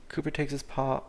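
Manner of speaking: ordinary talk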